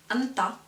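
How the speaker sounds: 안다 as 안따, first syllable ending on N; 안다 with only the N of the final pair; only N pronounced. Shown as a demonstration. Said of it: "which is N".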